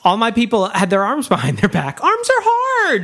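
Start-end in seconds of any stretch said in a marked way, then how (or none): 2.0-3.0 high, slow whining; high whine. Pretend. Whiny high drawn out complaint.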